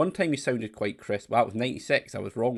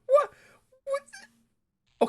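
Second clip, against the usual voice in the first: squeaky disbelief, half laughing; incredulous; 5 dB louder, slower than usual. Real, not acted. Baffled by the character's question.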